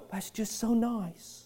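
soft, slow, indifferent; bored mock approval. A pastor mocking a complacent church, soft and slow. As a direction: say it like it barely matters. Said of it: "Now we just sit back and go".

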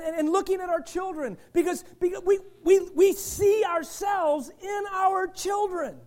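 loud, high, driving sermon delivery; full-force preaching. Acted. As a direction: preach it hard to the congregation. Preaching builds to a shout.

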